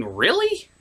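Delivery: ordinary talk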